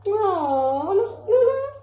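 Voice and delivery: high, lively cooing; excited mommy voice. Real, not acted. Mother distracting her baby.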